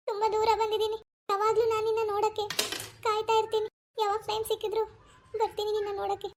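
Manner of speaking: missing him badly, fighting tears; tearful longing. [name] alone, missing her husband.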